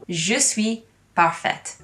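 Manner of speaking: Je suis parfaite in careful French, slow; slow self-affirmation in French, Je suis parfaite; French pronunciation. Shown as a demonstration. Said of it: "I could say".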